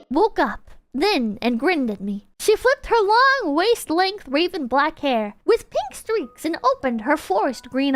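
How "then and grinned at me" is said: usual speaking voice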